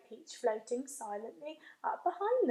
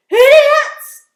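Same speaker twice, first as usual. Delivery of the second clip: very high, very loud insult; yelling; 13 semitones higher, 23 dB louder than usual. Acted. Centipede yelling at the cloud men, huge shout. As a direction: scream it at them. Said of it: "he yelled".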